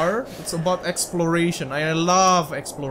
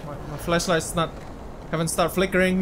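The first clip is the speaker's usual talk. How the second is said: usual speaking voice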